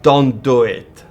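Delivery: put-on slow, loud, heavy German accent; stern warning in a thick German accent. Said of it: "with a very heavy German accent".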